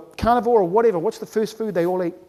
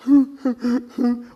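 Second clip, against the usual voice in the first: short whimpering sobs; mock sobbing; 2 semitones higher than usual. Upset faster with no comfort food, mock whimpering. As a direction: sob in pretend upset. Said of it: "you get upset".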